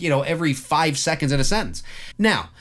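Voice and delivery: usual speaking voice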